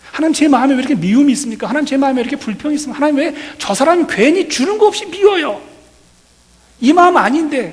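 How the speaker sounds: high, loud, fast pleading to God; complaining prayer. Retold or imitated. Pastor retelling his dawn prayer, high and fast pleading.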